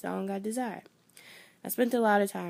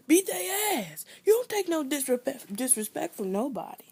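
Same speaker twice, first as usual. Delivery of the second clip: much higher, forceful imitated dad; tough dad; 7 semitones higher than usual. A father telling his daughter to fight back, mock-tough. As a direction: bark it like a dad who means it.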